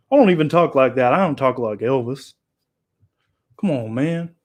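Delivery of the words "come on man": usual speaking voice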